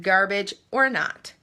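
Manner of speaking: normal talking voice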